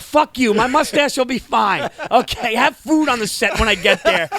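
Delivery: loud, high mock diva outburst; arrogant diva. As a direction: order them about like a hammed-up diva. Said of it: "I'm this diva".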